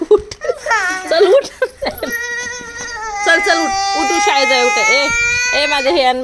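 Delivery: high, loud mock childish whining; playful whiny child. Mother teases daughter by acting her whiny demands, mock childish. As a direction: play the bossy little kid, half-laughing.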